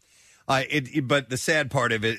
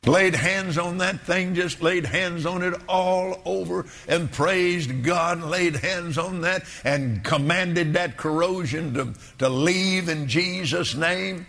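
preacher voice; 6 semitones higher than usual. Retold or imitated. Mocking a faith healer preacher.

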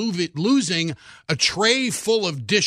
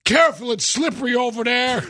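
North's quoted warning; 5 semitones higher than usual. Retold or imitated. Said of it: "then North says".

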